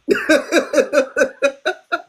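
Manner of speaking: loud laugh. Real, not acted. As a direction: let a laugh out. Laughing at Shannon.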